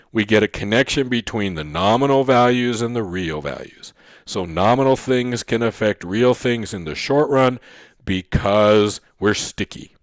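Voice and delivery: ordinary talk, nothing marked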